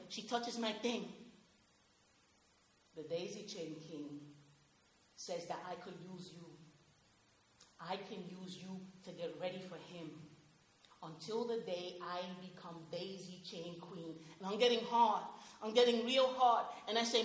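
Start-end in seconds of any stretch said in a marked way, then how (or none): 2.9-14.2 low, very soft voice; hushed, eerie murmur. Retold or imitated. A disturbed mother murmurs to her son, low and very soft. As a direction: murmur it like a secret spell.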